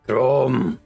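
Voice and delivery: normal talking voice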